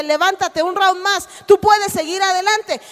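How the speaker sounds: a corner man yelling at a downed boxer; coach-like shout. Acted. God rallying a fighter on the canvas, loud shout. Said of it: "la voz de Dios dice".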